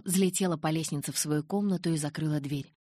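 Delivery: plain reading voice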